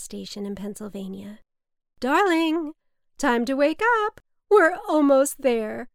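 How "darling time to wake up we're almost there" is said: louder, higher, cheerful wake-up; mother calling happily on the phone at dawn; hopeful, loud sing-song call; bright wake-up call. Acted. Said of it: "that start-over timbre to her voice".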